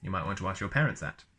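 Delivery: normal talking voice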